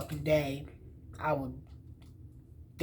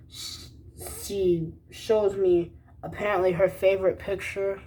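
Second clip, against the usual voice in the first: normal talking voice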